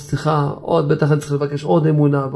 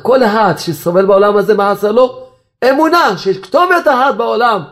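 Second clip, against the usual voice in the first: raised, emphatic proclamation; fervent preaching; 6 semitones higher, 3 dB louder than usual. Real, not acted. Rabbi telling sufferers faith is the answer, fervent. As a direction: build up to a high, ringing answer.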